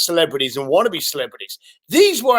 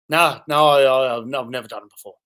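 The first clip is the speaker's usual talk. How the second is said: monotone; 5 semitones lower, faster than usual. Retold or imitated. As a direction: play a caller with no enthusiasm. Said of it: "monotone, no excitement".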